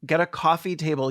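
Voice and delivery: usual speaking voice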